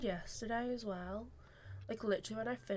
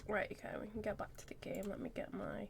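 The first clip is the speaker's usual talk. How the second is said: whispering; 9 dB quieter than usual. Real, not acted. Quiet aside while streaming.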